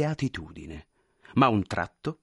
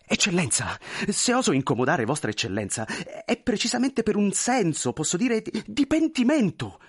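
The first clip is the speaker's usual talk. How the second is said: much higher, pleading, flustered voice; anxious clerk; 9 semitones higher than usual. Acted. Clerk pleading with the general, high and flustered. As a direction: beg forgiveness nervously.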